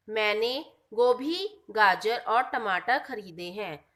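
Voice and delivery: slow example reading, stopping after "गोभी"; pause after गोभी. Shown as a demonstration. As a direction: break after "गोभी", then continue "गाजर और टमाटर". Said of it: "तो गोभी के बाद रुक गई". ("गोभी" के बाद रुककर धीरे पढ़ना)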